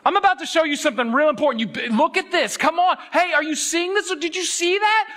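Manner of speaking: high-pitched doggy talk. Retold or imitated. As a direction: hype up a dog to look at something. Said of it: "that's the language here".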